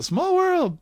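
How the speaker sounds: high, delighted exclamation; mock recognition. Hosts recognize the Small World clock tower, high and delighted. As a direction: exclaim it like spotting a landmark. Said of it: "We both went".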